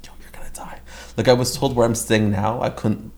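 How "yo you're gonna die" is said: hushed mock warning whisper. Locals warning her about the area, in a hushed whisper. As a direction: breathe it like a spooky warning. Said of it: "everyone in that area was just like".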